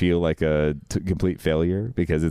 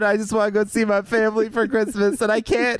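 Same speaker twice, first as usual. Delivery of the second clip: much higher, strained, near-tearful whine; whiny plea. Retold or imitated. Telling the insurance agent he's tired and broke, whiny. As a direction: beg like you're at the end of your rope.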